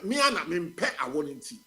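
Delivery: ordinary talk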